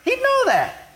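high-pitched, indignant accusation; accusing Simon. Acted. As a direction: argue the case against her, pitch climbing sharply at the end. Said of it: "Simon wanted Jesus to use the law".

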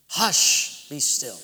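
hushed, then low and calm; calm rebuke. Acted. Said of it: "he rebuked the winds".

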